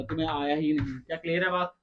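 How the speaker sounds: ordinary delivery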